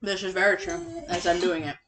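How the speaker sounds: talking through a mouthful of taco; chewing while speaking; mouth full. Real, not acted. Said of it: "you're not good to podcast with your mouth full".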